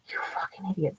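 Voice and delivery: whispered curse under the breath; whispered insult. Retold or imitated. Cursing at the clueless coworkers in a whisper. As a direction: hiss the insult out of earshot.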